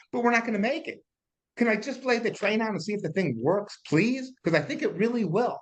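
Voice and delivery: pleading with the banker, pitch climbing to a begging peak; mock plea to the bank. To the bank for a loan, rising hammed-up begging. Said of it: "Man, I go to the bank, like".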